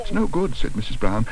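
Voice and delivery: high, anxious protest; distressed. A narrator's reading. Mrs Brown distressed outside.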